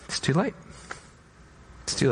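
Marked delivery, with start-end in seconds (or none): none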